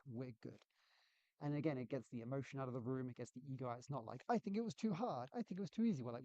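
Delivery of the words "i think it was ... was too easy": high-pitched imitation of opinionated colleagues; mock squabbling teammates. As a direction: parody two people bickering from gut feeling. Mimics opinionated teammates arguing.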